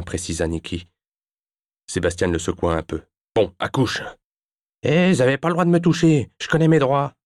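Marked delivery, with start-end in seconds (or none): 3.3-4.0 higher-pitched, short impatient order; impatient demand. A narrator's reading. Sébastien shaking the teenager for names, impatient. 4.8-7.1 high, loud, fast outburst; indignant protest. A narrator's reading. Teenager to the man shaking him, indignant.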